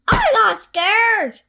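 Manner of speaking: high, loud bold little voice; brave child. Acted. Gruffalo's child boasting, high and loud. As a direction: insist you're not scared. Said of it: "said the Gruffalo's child".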